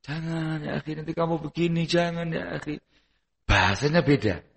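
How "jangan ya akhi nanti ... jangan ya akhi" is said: much softer, tender brotherly plea; gentle loving advice. Acted. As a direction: coax him kindly, almost hushed. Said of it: "Dia tunjukkan kasih sayangnya".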